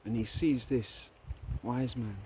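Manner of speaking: usual speaking voice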